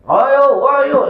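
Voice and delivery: mock call of ayo, ayo across the house. Teacher retells how he calls out and his wife scolds him, mild mock yell. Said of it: "saya teriak manggil".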